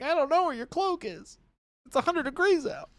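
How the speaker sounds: high-pitched flustered answer; mock exasperated reply. Answering Jordan's cloak demand in a high, flustered voice. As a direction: squeak it like a harried servant. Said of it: "I'm like".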